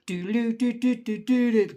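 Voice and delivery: high imitated chime; ice cream van jingle. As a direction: mimic the van's bell in doo-doos. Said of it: "heard that bloody bell".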